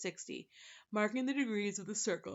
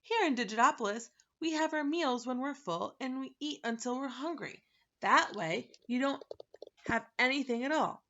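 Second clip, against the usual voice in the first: louder, brisk impatience; impatient; 5 semitones higher, 5 dB louder than usual. Acted. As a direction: explain it with little patience. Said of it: "he said impatiently".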